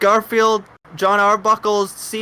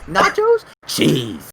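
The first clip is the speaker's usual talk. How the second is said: high excited voice; 11 semitones higher, 4 dB louder, slower than usual. Retold or imitated. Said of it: "And you're like".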